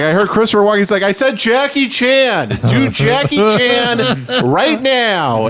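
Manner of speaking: high, slow laughing insistence; mock arguing heckler. Heckler and comic argue, high and drawn-out. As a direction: insist from the crowd.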